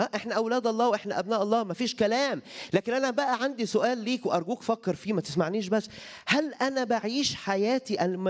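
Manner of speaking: preacher challenging each listener to examine their life; fervent preaching. Acted. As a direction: press the question home from the pulpit. Preacher rising to urge congregation.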